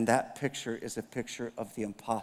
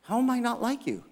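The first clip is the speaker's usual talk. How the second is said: indignant protest; 9 semitones higher than usual. Retold or imitated. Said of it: "I'm like".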